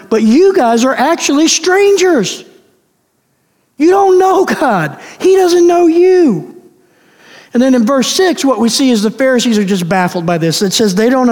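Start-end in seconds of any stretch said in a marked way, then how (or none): 0.1-6.6 raised pitch, firm and deliberate confrontation; pressing hard. Retold or imitated. Jesus confronting the Pharisees as strangers to God, pressing hard. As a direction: press them harder, pitched up and slowing down. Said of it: "Jesus presses even harder on them".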